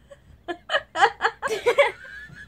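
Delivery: high laughter. Real, not acted. Laughing fit.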